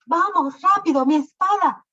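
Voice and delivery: high, loud urgent shout. Acted. King to Lancelot demanding his sword, loud high shout. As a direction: bark the order for a sword. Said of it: "le gritó a Lancelot".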